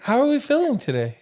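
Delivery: high sweet therapist voice; sing-song therapist. Retold or imitated. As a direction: coo like a kindly school therapist. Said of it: "She would always say that".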